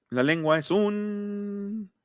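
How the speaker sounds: high, drawn-out "un" left hanging; sing-song fill-in-the-blank on "un"; leading prompt. Shown as a demonstration. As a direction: stretch "un" on a held pitch, waiting for the answer. Prompting class to complete sentence.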